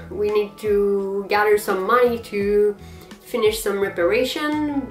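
ordinary talk, nothing marked

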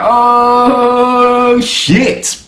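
high startled gasp, then dropping; mock shock. Reviewer reacts to Carol's movie offer, high mock surprise. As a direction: caught off guard by the offer. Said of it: "I was like".

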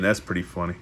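ordinary delivery, nothing marked

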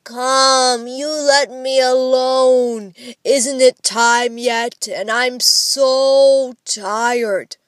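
loud, slow, drawn-out whine; dragged-out tired complaint; whining. A narrator's reading. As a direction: whine like a sleepy child refusing to get up. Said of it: "by whining out".

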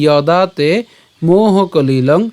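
"যদা তে মোহ কলিলং" very slow, long vowels; slow pronunciation demo. Shown as a demonstration. Teacher modelling the first line for learners, "যদা তে মোহ কলিলং" drawn out. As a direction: stretch "যদা তে মোহ কলিলং" syllable by syllable, y at the start. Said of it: "উচ্চারণটা কেমন হচ্ছে".

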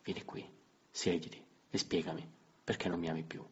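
soft, quiet coaxing; gently. Acted. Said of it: "Dissi più dolcemente che potei". (con dolcezza, voce sommessa)

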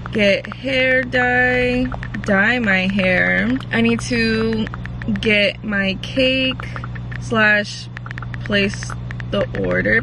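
slow, drawn-out, writing as she speaks; slow dictation. Real, not acted. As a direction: spell out each item slowly while writing. Writing a to-do list aloud.

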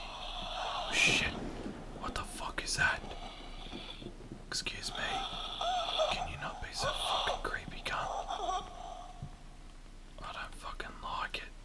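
harsh, urgent whisper; real scare hissed out in a whisper; frightened whisper. Real, not acted. Player telling a creepy ghost to back off, frightened whisper.

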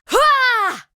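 high breathy battle exertion cry; effort shout. Acted. Casting a big attack, high effort cry. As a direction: put your whole body into the strike.

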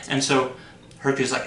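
plain reading voice

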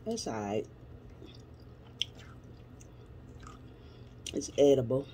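speaking between bites; chewing food while talking. Real, not acted. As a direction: mouth partly full of food. Seen 0: Took a forkful at -7; jaw chewing, food visible at +0.2.

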